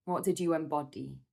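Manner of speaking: normal talking voice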